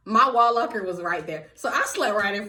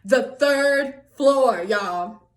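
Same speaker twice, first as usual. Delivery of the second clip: "third floor" stretched out very slowly; emphatic repeat; 3 dB louder, much slower than usual. Real, not acted. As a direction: punch each word of "The third floor" separately. Repeating the dreaded floor for effect.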